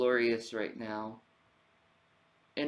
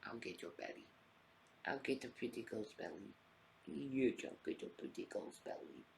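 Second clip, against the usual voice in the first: soft cooing to cat; 2 semitones lower, 13 dB quieter than usual. Real, not acted.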